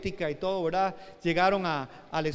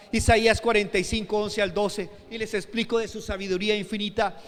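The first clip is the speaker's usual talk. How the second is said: ordinary talk, nothing marked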